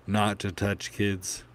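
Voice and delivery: ordinary delivery, nothing marked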